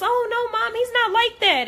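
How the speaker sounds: high-pitched voice of a daughter defending someone; Queen. Retold or imitated. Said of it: "Queen's just".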